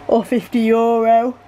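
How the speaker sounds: words stretched by a yawn. Real, not acted. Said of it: "I'm yawning".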